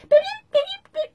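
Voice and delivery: very high beeping squeak; squeaky helium voice. Retold or imitated. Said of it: "she'd be like".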